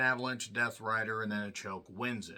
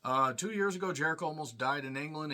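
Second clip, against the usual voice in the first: normal talking voice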